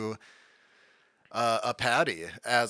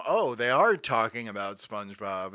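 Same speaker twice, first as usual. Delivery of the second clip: ordinary talk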